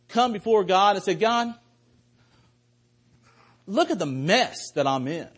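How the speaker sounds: full-voiced altar-call preaching; preaching at full force. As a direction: drive the appeal home to the congregation. Preaching climax of the sermon.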